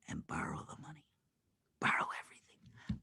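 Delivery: hushed, confidential whisper; secretive whisper. Acted. Secretive instruction to borrow, whispered.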